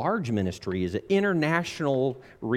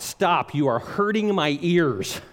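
raised, higher mock outcry; scream; 6 semitones higher, 4 dB louder than usual. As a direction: cry out to make it stop. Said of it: "I wanted to scream out".

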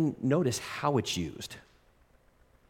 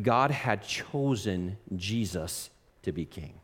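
ordinary delivery

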